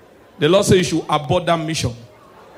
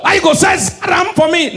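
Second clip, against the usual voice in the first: high, loud indignant yell; mock angry shout; 10 semitones higher, 4 dB louder than usual. Igbo boy confronts the prophet over the name, loud mock anger. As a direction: yell it, offended.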